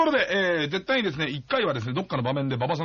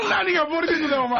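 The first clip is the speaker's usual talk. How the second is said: mock angry old man; 9 semitones higher than usual. Grandpa angrily retorting in skit.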